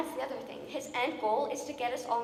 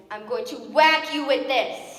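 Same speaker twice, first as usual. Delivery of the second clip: slow, loud threat; 3 semitones higher, 11 dB louder, slower than usual. Acted. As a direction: threaten to whack him. Threatening interrupter.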